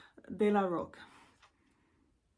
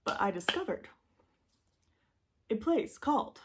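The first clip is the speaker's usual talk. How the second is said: ordinary talk, nothing marked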